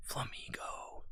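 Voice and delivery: hushed, unvoiced whisper. Real, not acted. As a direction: whisper the name very softly, drawn out. Names a card in a whisper.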